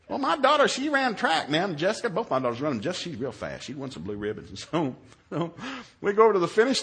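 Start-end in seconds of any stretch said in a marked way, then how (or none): none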